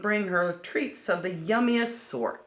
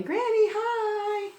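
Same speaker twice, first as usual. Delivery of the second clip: high, slow sweet hello; a small girl saying hi; little girl greeting; 5 dB louder, much slower than usual. Acted. Red greeting Granny in bed, high sweet child voice.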